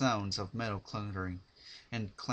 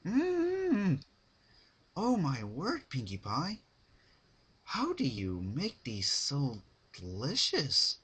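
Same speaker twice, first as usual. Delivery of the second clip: high savoring hum into slow praise; refined pony savoring a cupcake; delighted Rarity; 6 semitones higher, slower than usual. Acted. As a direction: relish the taste aloud.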